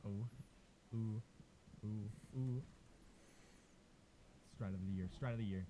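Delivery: ordinary talk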